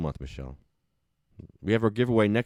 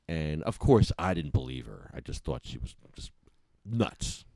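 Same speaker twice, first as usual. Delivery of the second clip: ordinary delivery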